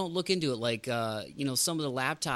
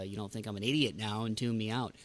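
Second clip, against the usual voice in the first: usual speaking voice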